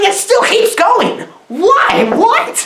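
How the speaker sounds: high-pitched, hammed-up bafflement; comic outrage climbing in pitch; exasperated disbelief. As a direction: fire off questions in rising disbelief. Disbelief that film continues.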